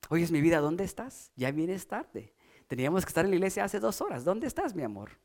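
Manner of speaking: soft, hushed and sweet, a hurried middle; sweet soft asking. Shown as a demonstration. Wife asking her late husband sweetly, soft and hushed. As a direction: ask gently, like a loving wife. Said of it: "Normalmente no es en ese tono".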